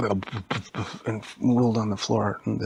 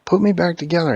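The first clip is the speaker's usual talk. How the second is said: higher, louder plea; loud pleading; 7 semitones higher, 8 dB louder than usual. Acted. Split Siege begs Elvie, loud high plea. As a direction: call out for help. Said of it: "The Siege was like".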